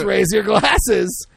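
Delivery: slow, drawn-out toast call; toast speech. Acted. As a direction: lift it like a toast to the room. Leading a drinking toast.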